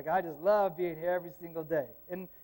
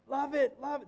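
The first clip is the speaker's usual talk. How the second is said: excited; 8 semitones higher than usual. Retold or imitated. Cheerfully answering embarrassing parents.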